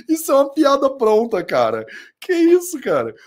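high, loud laughing disbelief. Real, not acted. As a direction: laugh through the words. Laughing at brand name.

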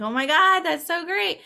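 high-pitched imitation of excited fans; mock fangirl excitement; gushing fans. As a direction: gush like a thrilled fan, voice lifted high. Acting out thrilled Scream fans.

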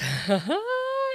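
high squeal of a laugh; giddy laugh. Real, not acted. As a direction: laugh up high, thrilled by the plot. Reacting excitedly to the story.

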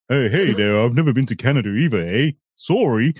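quick, hammed-up Canadian accent with a tagged-on eh; mock Canadian accent. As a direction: rattle it off like a joke Canadian. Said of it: "That's Canadian".

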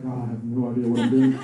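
low-pitched helpless plea into laughter; laughing mock prayer. Preacher retells praying at the computer, laughing helplessly. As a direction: crack up while imitating a clueless prayer.

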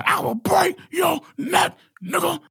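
high, slow, drawn-out mock threat. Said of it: "must be [name] when he told [name]".

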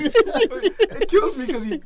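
helpless high laughter through the words; barely getting it out for laughing; cracking up. Real, not acted. Mocking a player's bad shot to friends, cracking up.

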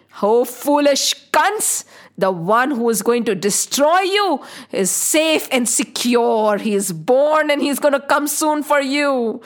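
slow, portentous prophecy of doom; theatrical, emphatic deity's threat; commanding goddess. Acted. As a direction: deliver a stern divine warning. Voices goddess warning the king.

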